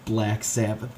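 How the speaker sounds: usual speaking voice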